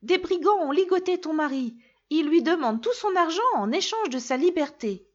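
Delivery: s'écrier d'une voix plus aiguë; cri d'alarme, voix plus aiguë. A narrator's reading. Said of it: "Le petit poucet s'écria". (s'écrier d'une voix plus aiguë, alarmé)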